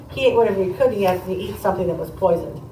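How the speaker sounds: normal talking voice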